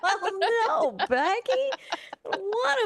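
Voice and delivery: high-pitched laughing reply. Real, not acted. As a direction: laugh through the words. Laughing at floss advice.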